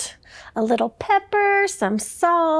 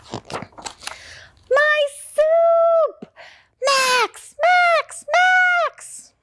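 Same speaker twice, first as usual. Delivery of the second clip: frantic cry; 12 semitones higher, much slower than usual. Acted. Max crying out over his ruined soup, frantic. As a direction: shout in dismay at the ruined soup.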